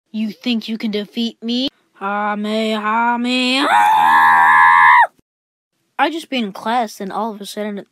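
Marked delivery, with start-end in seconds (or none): 0.1-5.0 building to a huge anime battle yell; Kamehameha shout. Retold or imitated. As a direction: charge up and scream the attack. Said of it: "Last time on Dragon Ball Z".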